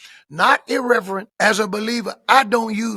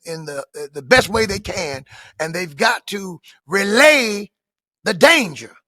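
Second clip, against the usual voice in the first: normal talking voice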